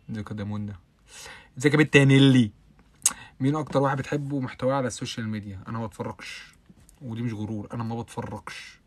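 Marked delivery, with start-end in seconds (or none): 1.6-2.5 loud greeting. Real, not acted. Greeting a viewer by name.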